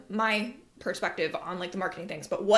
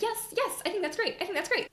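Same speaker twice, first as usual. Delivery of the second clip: high, soft, people-pleasing yes-man; mock agreeable friend; 10 semitones higher, 3 dB quieter than usual. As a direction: gush softly to keep everyone happy. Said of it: "I'm gonna be like".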